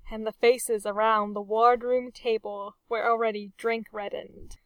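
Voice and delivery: usual speaking voice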